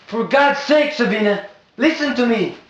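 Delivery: desperate cry. Acted. George cries out to Sabina, loud anguished plea. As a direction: cry out for her to listen.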